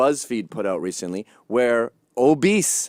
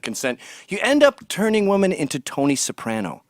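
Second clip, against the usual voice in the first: ordinary talk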